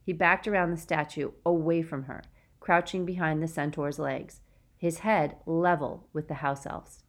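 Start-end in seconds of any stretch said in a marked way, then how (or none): none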